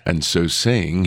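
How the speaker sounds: usual speaking voice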